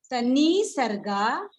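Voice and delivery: तनी सर्गा slowly, "nee" and "gaa" drawn out; slow corrective demo. Shown as a demonstration. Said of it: "आप pronounce करेंगे". (तनी सर्गा का धीमा, खिंचा उच्चारण)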